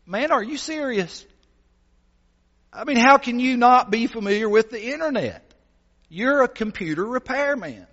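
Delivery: pitched high in disbelief at the repairman; incredulous. Acted. Customer baffled that a repairman never heard of internet, incredulous. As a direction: ask it like you can't believe your ears.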